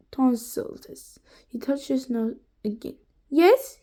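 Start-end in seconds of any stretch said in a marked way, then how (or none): 3.3-3.8 higher, polite Scottish gentleman; pleasant Scottish accent. A narrator's reading. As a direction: answer the door like a Scottish gent. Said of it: "in a pleasant voice from the Scottish gentleman".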